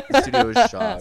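high loud announcer voice; dramatic announcer. Pretend. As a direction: boom it like a TV host. Repeated louder, higher, put-on.

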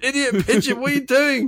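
high-pitched, exasperated scolding; mock road rage. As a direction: play a driver yelling at a stubborn pigeon. Acted driver berating a pigeon.

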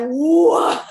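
loud high stunned "what"; shocked. Retold or imitated. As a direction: react in total disbelief. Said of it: "I was like".

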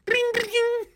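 very high ringing "bing, bing"; bicycle bell imitation. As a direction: squeal out two little bell dings. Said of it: "he's ringing the little bell".

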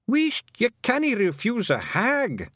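very high, slow Scottish wee man; Feagle from the back. A narrator's reading. A Feagle hushing the clan, very high Scottish voice. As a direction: hush them from safety at the back. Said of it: "another Feagle somewhere safe at the back said".

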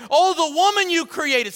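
high, loud protest; blame-shifting Adam. Acted. Adam blaming the woman before God, high protest. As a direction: throw the blame back, loudly. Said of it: "And then he's like".

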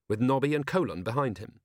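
ordinary delivery, nothing marked

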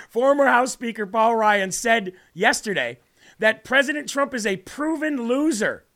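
ordinary delivery

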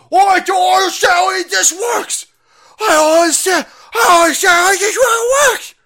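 high, very loud mock wail; mock bewildered shouting. Mocking viewers who don't understand, hammed-up shouting. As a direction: screech it like a clueless complainer.

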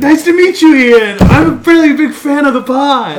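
rabid fan. Pretend. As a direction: play an overexcited fan meeting his idol. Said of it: "I'll pretend like I'm a rabid fan".

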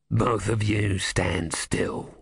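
low, very slow cold command; menacing deacon. Acted. Deacon catches intruders in doorway.

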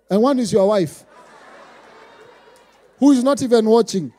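loud, climbing pulpit cry; building sermon shout, half sung at the end; full-force preaching. Acted. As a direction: preach it at the top of your voice.

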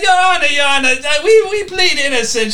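loud, hasty appeal to the judge; yelled plea. Acted. Defender outside the courtroom pleading to the judge, yelling. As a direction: yell it to the court before your turn. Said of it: "you're just yelling".

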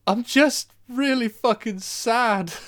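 slow, high mock-sob of a weepy actor; hamming up the actor crying his line, slow and high; mock tearful actor. Retelling the film scene to his co-host, a hammed-up slow, high weepy delivery. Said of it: "bursts into tears, goes".

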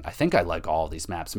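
normal talking voice

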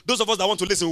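normal talking voice

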